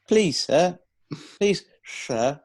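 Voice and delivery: a hint of a kid asking sir to pick him; mock schoolboy pleading. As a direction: beg the teacher for your turn like a pupil. Begging like a schoolboy for a turn.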